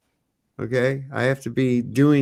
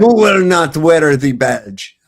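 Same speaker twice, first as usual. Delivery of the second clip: loud mock proclamation; 3 semitones higher, 9 dB louder than usual. Mocking slogan from the clip.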